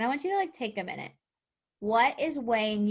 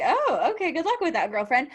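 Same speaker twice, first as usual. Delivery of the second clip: sarcastic dismissal; 5 semitones higher, 4 dB louder than usual. Retold or imitated. Sarcastic reaction to dull influencer.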